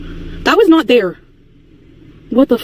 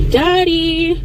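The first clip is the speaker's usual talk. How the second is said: high sing-song call into the woods; 6 semitones higher than usual. Acted. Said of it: "I'm not yelling, Daddy".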